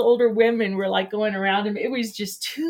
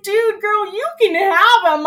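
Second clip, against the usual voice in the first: high, loud retold protest; worked-up, incredulous quote; exasperated retort; 9 semitones higher, 7 dB louder than usual. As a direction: hand him over in a loud, high mock plea. Retold exasperated reply to girl.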